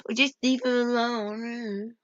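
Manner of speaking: quiet, dragged-out mock pleading; mimicked plea. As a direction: imitate a feeble plea, drawn out. Mimicking opponent in retold argument.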